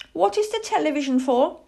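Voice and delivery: high, quick little owl voice; curious owlet. Acted. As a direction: ask in piping innocence. Plop curiously questions the man.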